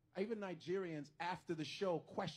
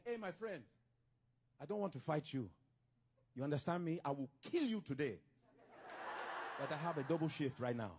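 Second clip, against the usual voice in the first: low, mock-threatening Nigerian accent; 5 semitones lower than usual. Nigerian threatening a man before a double shift, mock-menacing Nigerian accent. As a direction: talk tough in a put-on Nigerian accent. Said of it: "You'll never see a Nigerian fighting".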